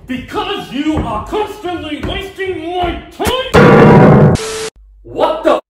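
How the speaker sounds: usual speaking voice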